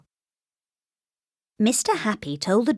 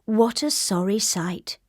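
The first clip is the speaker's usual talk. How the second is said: ordinary delivery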